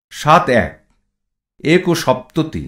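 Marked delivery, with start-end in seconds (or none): none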